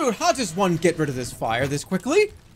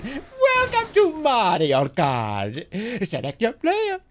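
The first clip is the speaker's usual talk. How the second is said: slow stretched announcer voice, climbing higher at the end; big showman game announcer; hyped announcer; 3 semitones higher, much slower than usual. Shown as a demonstration. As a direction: sell it like a game intro. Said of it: "I could have done".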